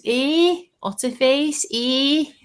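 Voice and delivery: very high-pitched Scouse squawk; Scouse accent. Retold or imitated. Co-host mimics a Scouser to friends, high Scouse accent. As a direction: call out as a high, mock Scouser. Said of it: "there's a Scousers".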